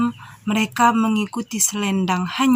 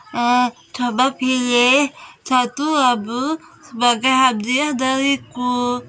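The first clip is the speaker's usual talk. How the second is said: put-on old grandmother. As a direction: draw the words out like an elderly granny. Old grandmother offers a gift.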